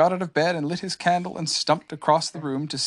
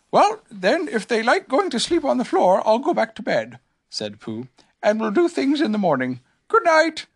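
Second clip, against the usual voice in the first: small gentle bear; Pooh voice; 9 semitones higher, 3 dB louder than usual. A narrator's reading.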